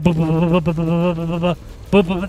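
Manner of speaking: rapid bu-bu-bu gunfire imitation; loud staccato mock machine gun; mouth gun noises. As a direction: spit out gun sounds like a kid playing. Imitating gunfire while shooting.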